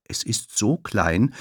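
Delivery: ordinary delivery, nothing marked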